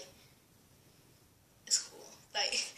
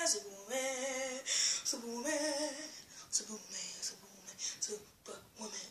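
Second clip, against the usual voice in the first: each repeat softer until a whisper; fading repetition; 9 dB quieter than usual. Real, not acted. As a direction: let the name fade away to a whisper.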